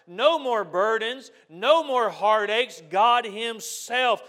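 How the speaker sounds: preaching at full force. Acted. Preacher telling the congregation God wipes tears, at full force. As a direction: drive it home to the congregation.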